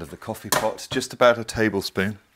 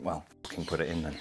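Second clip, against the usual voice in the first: put-on Yorkshire accent; 7 dB quieter, faster than usual. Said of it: "And he went".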